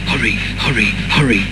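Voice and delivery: puffed-out engine chant; puffing. Acted. Henry pulling his train at speed, puffing. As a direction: puff each word like a chugging engine. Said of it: "puffed Henry".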